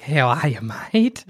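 put-on broad bogan Australian accent, rising on mate; mega bogan. Mega bogan coming up to you, broad Australian accent. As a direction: greet like a broad Aussie bogan. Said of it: "mega bogan still comes up to you".

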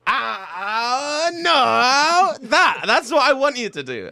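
high, loud yelp of protest that turns into a complaint; pained cry. Acted. Servant slapped instead of master, loud pained protest. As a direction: cry out as the wrong one gets hit.

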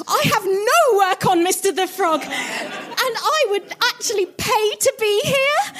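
high-pitched, keen plea to an idol; eager fan. Acted. Fan tells Kermit she would pay to be there, eager.

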